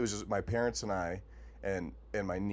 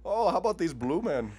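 exaggerated Canadian accent, pitched up; mock Canadian accent; 9 semitones higher, 5 dB louder than usual. Riffing on the Blue Man Group crowd, mock Canadian accent. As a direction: keep the Canadian joke going, higher. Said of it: "a real hard Canadian accent".

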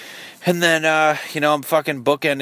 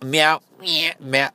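high, pitched-up meow; cat meow imitation; 7 semitones higher, slower than usual. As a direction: mimic a cat meowing, higher than usual voice. Imitating a cat's meow badly.

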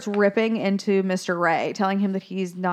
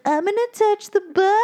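daring little seahorse; 12 semitones higher than usual. Retold or imitated. Seahorse showing off to his friends, high and daring. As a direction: put on a high kiddie seahorse voice. Seahorse announces a dare in retelling.

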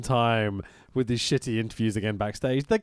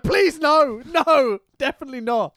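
pleading; 12 semitones higher, 6 dB louder than usual. Retold or imitated. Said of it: "I'm like".

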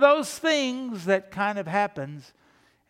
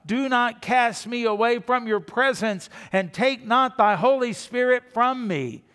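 higher, louder pleading cry to God; desperate plea; 6 semitones higher, 5 dB louder than usual. Retold or imitated. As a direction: beg not to be cast away. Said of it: "he desperately cries out".

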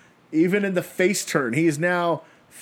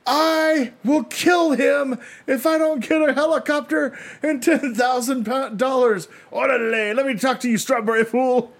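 hostage taker voice; 8 semitones higher than usual. Pretend. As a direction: yell demands like a crazed kidnapper. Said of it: "Can you imagine that hostage, uh, scene?".

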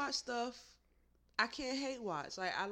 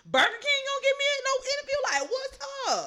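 much higher, incredulous; mock outrage; 14 semitones higher, 5 dB louder than usual. Real, not acted. Ranting about Burger King ignoring her, high and indignant. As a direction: act offended, pitch way up.

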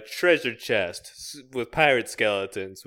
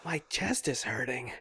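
pained whisper; 9 dB quieter than usual. Acted. Character having heart attack.

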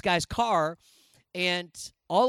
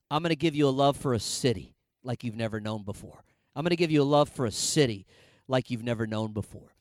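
low, softened voice of God; hushed, low divine promise; 6 semitones lower than usual. Retold or imitated. Voices God speaking to him repeatedly.

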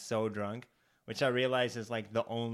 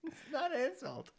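much higher, laughing protest; 13 semitones higher than usual. Pretend. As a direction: squeal it while laughing. High laughing mock.

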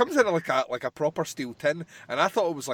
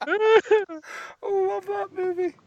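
gushing fan; 6 dB louder, much slower than usual. Real, not acted. Fond outburst, high cry.

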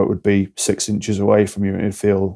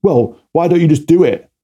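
exasperated snap; 6 semitones higher, 4 dB louder than usual. Retold or imitated. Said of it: "It's not always".

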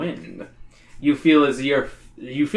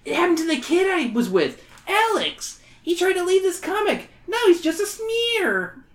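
high, whiny schoolkid; whiny kid voice; 12 semitones higher than usual. Acted. Wally character voice.

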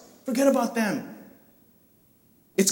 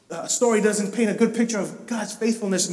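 ordinary talk, nothing marked

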